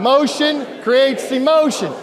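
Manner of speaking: loud, slow, high energetic shout. Shown as a demonstration. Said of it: "a little more energy".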